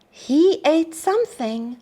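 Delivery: ordinary delivery, nothing marked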